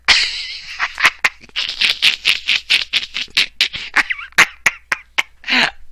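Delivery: long wheezy, breathless laughing fit; uncontrolled laughter. Real, not acted. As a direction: crack up completely and keep going. Cracking up at a tweet.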